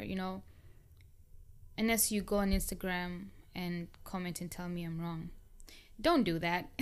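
normal talking voice

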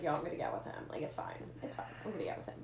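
low, hushed aside retelling; confiding in friends. Retold or imitated. Telling friends about a crush, confiding quietly. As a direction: murmur it like a secret plan. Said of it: "talking to friends. I'm like".

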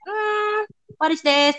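high drawn-out hum, then a doubtful question; mock puzzlement over what's on the page; puzzled reader. Recruiter skimming a weak CV summary, mock puzzled.

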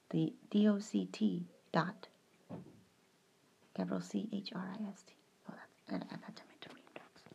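unvoiced whisper spelling letters. Real, not acted. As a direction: whisper it to yourself.

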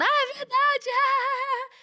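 mock a pushy seller yelling in the store; mocking a shouting salesperson. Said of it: "tem alguém gritando e falando".

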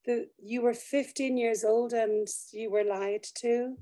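ordinary talk

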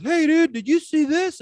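high, eager friend calling out; buddy's excited call. Retold or imitated. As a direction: pitch it way up like a stunned buddy. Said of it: "and he goes".

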